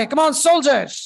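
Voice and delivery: high, loud drill call; rallying shout. Real, not acted. Teacher urges class to repeat together, loud rallying call. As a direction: call out to rouse the troops.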